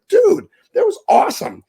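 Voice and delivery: very high, loud excited cry; excited rave. Real, not acted. Fan raving to listeners about the match, overjoyed. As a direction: gush over the match at full volume.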